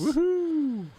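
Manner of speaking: high excited whoop; cheering whoop. Real, not acted. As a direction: cheer him onto the show. Cheering guest arrival.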